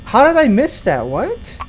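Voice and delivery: high, loud, exasperated outburst; frustrated outburst. Real, not acted. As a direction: vent your disbelief at the game. Frustrated after losing.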